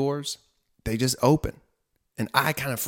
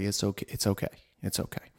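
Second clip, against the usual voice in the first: soft reassurance; 2 semitones lower, 6 dB quieter than usual. Retold or imitated. Son reassuring the nurse softly.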